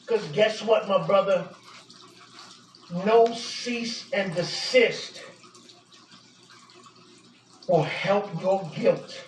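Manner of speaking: low, measured, solemn verdict; controlled disappointment after the fury; grave reproach. Real, not acted. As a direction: deliver a sober final judgment, pitch dropped low. Grave, lowered verdict after the outburst.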